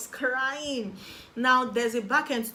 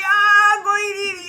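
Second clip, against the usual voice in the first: loud, higher mock crowd yell; crowd shout; 13 semitones higher, 16 dB louder, much slower than usual. Students rushing into the lecture theatre, shouting as they go.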